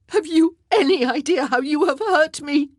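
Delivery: high, loud, tearful outcry; sobbing. A narrator's reading. Wife confronts unfaithful husband, sobbing loudly. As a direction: cry out your hurt. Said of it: "she sobbed".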